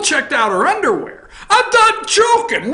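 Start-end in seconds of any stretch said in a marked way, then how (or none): 1.5-2.7 much higher, slow, pleading voice; mock whiny high voice. To an angry listener, a mock whiny high plea. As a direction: whimper it like a scolded kid.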